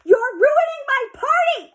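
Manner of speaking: high, loud furious yell; girl yelling at her friends. A narrator's reading. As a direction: scream it at them. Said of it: "I yelled".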